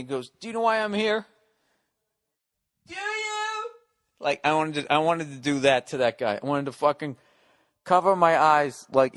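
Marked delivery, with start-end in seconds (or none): 0.4-3.7 high, strained imitation of a tearful breakdown; hammed-up weepy plea; mock crying. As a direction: act out a movie cry, climbing to a squeal. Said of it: "when he starts crying".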